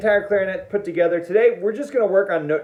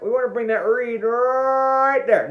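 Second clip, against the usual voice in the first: ordinary delivery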